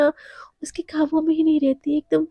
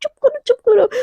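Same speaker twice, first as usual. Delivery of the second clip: urgent pleading hush; 9 semitones higher than usual. Acted. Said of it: "बहुत request कर रही होती है".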